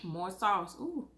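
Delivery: usual speaking voice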